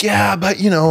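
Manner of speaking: high-pitched imitation of a protesting coach; mock protest. Assistant coach pushing back on the player, mock protest. Retold coach's hesitant objection.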